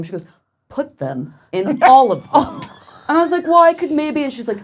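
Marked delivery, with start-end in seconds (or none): 0.7-2.4 slow, loud, raised-pitch command; mock-bossy wife, louder and higher; insistent order. Wife's order acted out. 3.4-4.2 high, loud, wavering concession; mock-timid backing down, pitched way up; flustered protest. Caving to wife's demand.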